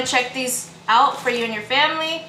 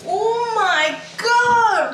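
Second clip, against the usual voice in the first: shocked; 9 semitones higher, much slower than usual. Real, not acted. Reacting to spicy noodle.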